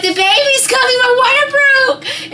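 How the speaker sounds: very high, loud panicked yell. Retold or imitated. Wife telling husband her water broke, yelling. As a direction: shout the urgent news, stretching the end. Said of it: "I started yelling at my husband".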